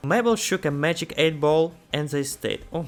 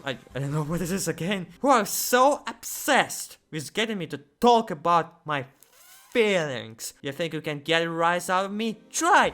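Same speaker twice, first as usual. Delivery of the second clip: angry Bill Cipher; 3 semitones higher than usual. Acted. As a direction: sneer at them, then fling the dare. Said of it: "we literally made Bill Cipher angry".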